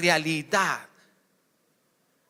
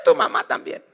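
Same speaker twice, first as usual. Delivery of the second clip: shrill insult; 4 dB louder than usual. Acted. Quarrel insult retort.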